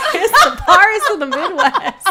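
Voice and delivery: loud laughing "Paris" as PAHR-iss; mispronounced Paris. Shown as a demonstration. Repeating the joke to co-host, "Paris" as PAHR-iss. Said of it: "Did you just say Paris?".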